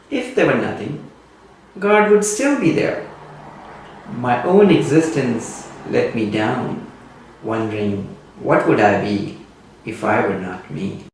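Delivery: ordinary delivery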